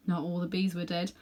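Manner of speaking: ordinary delivery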